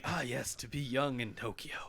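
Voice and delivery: soft, mock-wistful musing; lightly voiced king. King musing on the teenagers, lightly voiced wistfulness. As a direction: sigh it quietly like a wistful ruler. King muses about youth.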